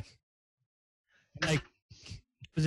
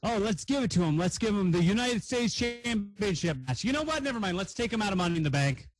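higher-pitched mock bookers; mock decision-makers; 5 semitones higher than usual. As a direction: voice fickle bosses, pitched up then backpedalling. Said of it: "they were like".

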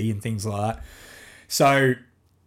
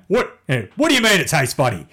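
mock dad telling off; 5 semitones higher, 5 dB louder than usual. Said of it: "You know?".

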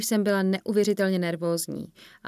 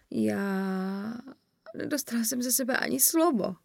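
dazed start, rising to a higher wail; dismayed; 4 semitones higher, slower than usual. A narrator's reading. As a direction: lament your own failure, mortified. Mortified friend comes out of shock. (zdrceně, naříkavě)

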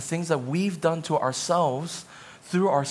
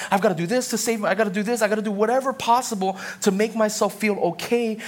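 anxious inner voice; 6 semitones higher than usual. Retold or imitated. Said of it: "you're like".

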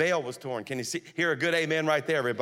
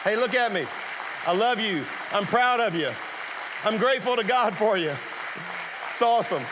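raised, high voice over applause; loud heartfelt praise; 6 semitones higher, 4 dB louder than usual. Real, not acted. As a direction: call out warm praise loudly. Praising pastor over crowd noise.

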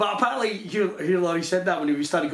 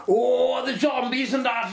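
hammed-up Irish accent, pitched up; bad Irish accent; 7 semitones higher, slower than usual. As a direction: do a terrible put-on Irish accent. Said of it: "gave two of the lead characters Irish accents".

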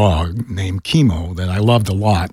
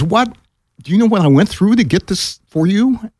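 higher, indignant disbelief; incredulous boss; 9 semitones higher than usual. Retold or imitated. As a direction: play the shocked boss who went to bat for you. Boss stunned by refusal.

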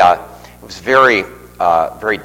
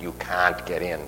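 quieter imitation of a thick Boston accent; 7 dB quieter than usual. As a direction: lightly mimic a Boston gentleman's accent. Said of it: "with a real thick Boston accent".

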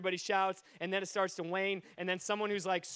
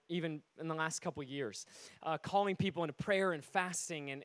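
usual speaking voice

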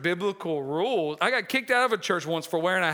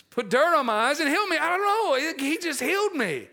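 flustered blind man; 8 semitones higher, faster than usual. Retold or imitated.

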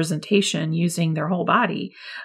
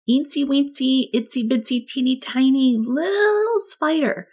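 tiny spider voice; 6 semitones higher than usual. Shown as a demonstration. Teacher voicing a tiny spider for children, high and small. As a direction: make yourself sound tiny and sweet. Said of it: "use a very soft voice 'cause he's so small".

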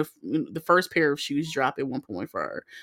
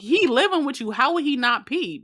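incredulous; 7 semitones higher than usual. Retold or imitated. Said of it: "I'm like".

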